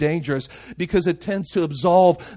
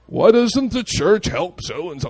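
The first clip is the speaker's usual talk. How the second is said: lightly mimicked complaint, drawn out; mock complainer; 4 semitones higher, 6 dB louder, slower than usual. Preacher mimicking people blaming the church, mock complaining. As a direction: imitate a complaining churchgoer, slowly.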